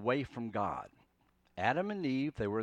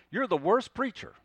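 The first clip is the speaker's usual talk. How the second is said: loud, raised mock insult; crisis language; 7 semitones higher, 5 dB louder than usual. Listener attacking the preacher in extreme words, mock angry. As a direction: throw it out as a hammed-up accusation. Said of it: "It's these extreme, uh, words that we use".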